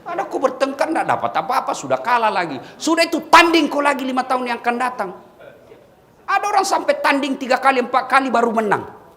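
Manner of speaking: loud fiery sermon voice; forceful preaching. Acted. Preacher urging election losers to accept defeat, loud and fiery. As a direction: preach it hard to the whole crowd.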